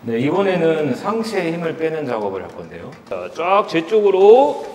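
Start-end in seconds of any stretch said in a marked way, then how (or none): 3.1-4.6 booming, drawn-out hypnotic command; loud slow command. Acted. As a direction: pull them in, slow and loud.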